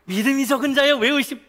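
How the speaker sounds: laughing retelling. Acted. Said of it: "이렇게 웃으시는 거예요".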